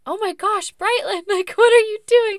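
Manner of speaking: high, loud startled cry of disbelief; shocked outcry. Retold or imitated. Mother finds her sleepwalking toddler, high loud shocked cry. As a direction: yelp it out, shocked and half laughing. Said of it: "And I was like".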